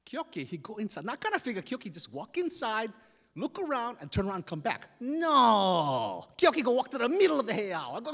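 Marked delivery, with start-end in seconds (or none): none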